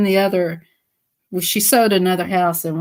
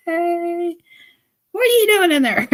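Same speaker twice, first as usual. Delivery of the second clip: put-on high, playful baby-talk; high cooing voice to the phone; 10 semitones higher than usual. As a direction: coax the hidden phone in a high teasing voice. Talking to Siri in phone.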